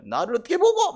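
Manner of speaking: high, loud offended outburst; mock yell of an insulted upright man; shouting offence at a bribe; indignant shout. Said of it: "고함을 지르는 사람도 있습니다".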